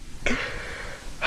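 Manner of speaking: soft, acted sigh; resigned sigh. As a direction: let out a quiet giving-in sigh. Giving in to boyfriend.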